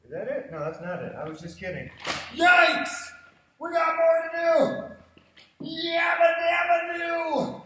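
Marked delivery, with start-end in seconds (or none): 2.4-7.6 high, slow drawn-out yell; playful shout. Pretend. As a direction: belt it out like a cartoon. Coach yells playful catchphrase.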